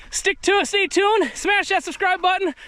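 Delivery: excited. Real, not acted. Hyped channel plug.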